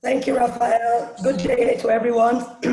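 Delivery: croaky, frog in the throat. Real, not acted. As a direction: speak through a croaky throat. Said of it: "Sorry for the croaky voice".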